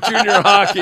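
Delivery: high, loud quote over laughter; mock taunt. Host retells the junior hockey jab at the coach, loud imitation. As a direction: call it out, amused, as the provoker.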